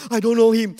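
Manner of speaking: loud, high, panicked denial. Retold or imitated. Peter denying Jesus to the servant girl, loud and panicked. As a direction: blurt the denial out.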